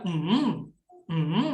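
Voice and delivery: hummed MM dipping, then rising; third tone MM. Shown as a demonstration. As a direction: hum MM down and back up. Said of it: "Nada tiga itu".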